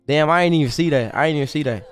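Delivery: usual speaking voice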